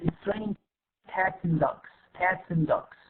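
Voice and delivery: AND reduced to a short 'n', linked to cats; reduced form. Shown as a demonstration. Teacher has students repeat after her, AND reduced to 'n'. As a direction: model cats and dogs linked, AND barely an 'n'. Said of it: "حاولوا تجربوا تقولوها وراي".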